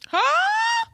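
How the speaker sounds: surprised. Real, not acted. Reacting in disbelief.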